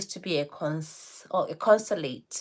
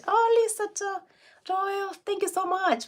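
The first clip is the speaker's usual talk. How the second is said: high, bright thrill at a viewer's name; delighted greeting. Real, not acted. Host greeting a viewer in the live chat, delighted.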